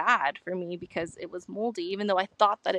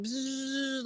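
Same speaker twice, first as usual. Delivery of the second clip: soft imitated bzzz of a fly, quieter; buzzing fly noise; 3 semitones higher, 8 dB quieter than usual. Vlogger imitates the fly buzzing around her on the hike, soft buzz. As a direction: buzz through the lips like a fly circling. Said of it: "this fly started buzzing".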